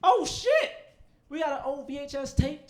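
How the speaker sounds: sudden high yelp of surprise; surprised outburst. Real, not acted. Startled by an old VHS tape. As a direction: blurt it out, caught off guard. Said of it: "Sorry, I got distracted".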